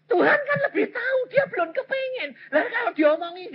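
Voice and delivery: high, crying voice; tearful. Real, not acted. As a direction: choke up as you say it. Preacher moved while explaining. (suara menangis, tinggi dan terharu)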